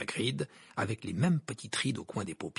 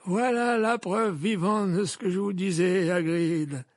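put-on high, loud old wizard voice; gently probing old headmaster; Dumbledore voice; 8 semitones higher, 5 dB louder than usual. A narrator's reading. Dumbledore asks Hagrid about Hermione's shout, raised old wizard voice.

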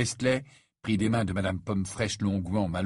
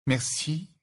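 slightly stilted thanks, pitched up; a touch of stiffness, higher voice; stiff; 6 semitones higher than usual. A narrator's reading. Fiancée accepting the mother's offer, stiff. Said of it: "répondit Fleur avec raideur".

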